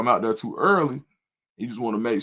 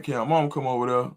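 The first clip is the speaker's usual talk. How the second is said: usual speaking voice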